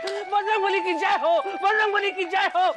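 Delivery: high-pitched frantic chant to the god; startled, high devotional shouting; panicked invocation. Acted. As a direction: cry out the praise in a panic. Repeated panicked chanting in temple.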